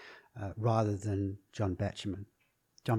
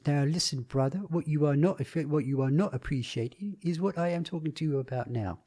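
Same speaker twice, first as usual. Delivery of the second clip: officious, over-precise shop steward, pitched up; Peter Sellers's officious shop steward; 5 semitones higher than usual. Acted. Actor mimics a film shop steward addressing a brother, officious. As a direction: lecture them pompously in a higher, put-on voice. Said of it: "who would talk like this".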